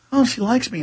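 high, soft, smitten whisper of delight; smitten viewer. Retold or imitated. Host on treadmill imagines anchor likes him, swooning softly. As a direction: swoon quietly like a fooled viewer. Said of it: "I'll start going".

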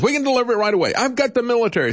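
high-pitched mock Trump boast; eager Trump quote. Host mimicking Trump promising military vaccine delivery, high-pitched eager boast. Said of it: "says Trump".

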